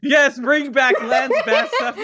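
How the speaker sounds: high, loud laughing shout of agreement; gleeful cheering. Real, not acted. Fan backing co-host's casting wish, gleeful shout.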